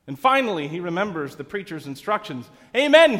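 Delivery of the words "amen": loud, high Amen; shout. Acted. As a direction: shout it in relief to stop the horse. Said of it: "he shouts".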